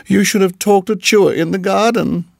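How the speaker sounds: high, plaintive creature voice; timid muskrat, anxious. A narrator's reading. Muskrat warning the mongoose about Nag, anxious. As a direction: fret in a high little voice.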